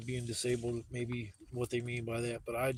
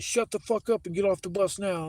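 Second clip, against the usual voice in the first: higher and louder mock yell at the passenger; retold cop shouting; 6 semitones higher, 5 dB louder than usual. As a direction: snap it out like an angry cop cutting him off. Cop cuts him off shouting.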